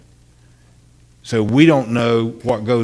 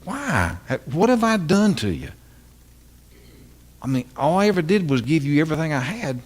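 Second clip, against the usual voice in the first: higher, anguished plea; Jesus pleading; 5 semitones higher than usual. Retold or imitated. Jesus looking at churchgoers, asking why, hurt. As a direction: cry out why like a betrayed giver. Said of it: "can't you just hear him say".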